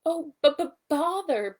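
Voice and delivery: high, loud stammered bother; exclaiming. A narrator's reading. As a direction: sputter out the word as you bounce. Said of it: "Pooh exclaimed".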